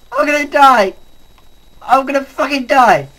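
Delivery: high, loud cry of panic; panicked yell. Real, not acted. About to be killed.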